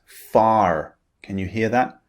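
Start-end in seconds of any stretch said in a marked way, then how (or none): 0.1-1.0 far with the R sounded at the end; American English far with final R. Shown as a demonstration. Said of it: "the R at the end of the word is pronounced".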